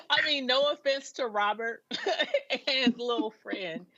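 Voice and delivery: laughing while talking. Real, not acted. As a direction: let chuckles break up the phrase. Laughing at the grifter story.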